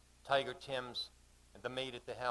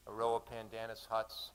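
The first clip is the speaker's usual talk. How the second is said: plain reading voice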